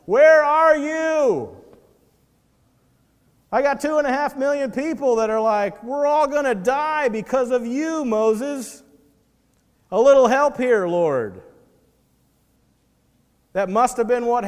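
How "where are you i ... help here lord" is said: high, loud, desperate cry to God; exasperated Moses. Retold or imitated. Moses crying out to the Lord, high and hammed-up. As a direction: Moses throws up his hands at the Lord. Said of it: "he's like".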